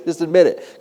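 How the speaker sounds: ordinary talk, nothing marked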